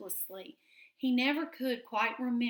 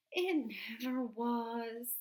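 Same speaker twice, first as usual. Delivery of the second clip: sighed; 2 semitones higher, 3 dB quieter, slower than usual. A narrator's reading. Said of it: "sighed the squirrel".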